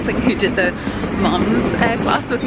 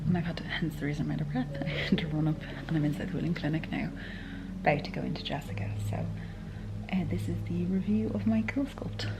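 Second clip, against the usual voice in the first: soft whisper to the camera; whispered vlog; 12 dB quieter than usual. Real, not acted. Whispering to the camera in the clinic waiting room. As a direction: whisper so the clinic can't hear.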